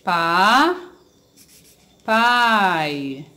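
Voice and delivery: PAPAI split into PA, long pause, drawn-out PAI; syllable by syllable. Shown as a demonstration. Teacher separating a word for pupils, syllable by syllable. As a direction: stretch "Pa", stop, then stretch "pai". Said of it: "em sílabas separadas".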